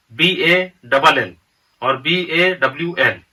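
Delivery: B A double L spelled slowly, letter by letter. Shown as a demonstration. Teacher spells ball for students, letter by letter. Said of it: "इसी तरह से ball".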